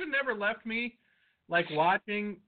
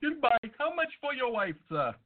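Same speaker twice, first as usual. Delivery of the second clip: mock Indian agent; 5 semitones higher than usual.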